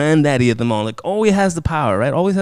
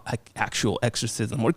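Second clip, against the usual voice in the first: ordinary talk, nothing marked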